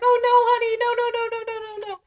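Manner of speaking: high, cooing string of reassurance; fussing teacher. Retold or imitated. Teacher comforting upset pupil, high mock-soothing voice. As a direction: imitate a teacher hurrying to comfort a child.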